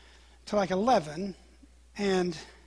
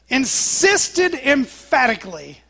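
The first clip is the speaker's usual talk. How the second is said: loud, high and drawn out; emphatic; 4 semitones higher, 8 dB louder, slower than usual. A narrator's reading. Preacher telling how Peter defied Jesus, slow and emphatically loud. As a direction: hammer out each word slowly.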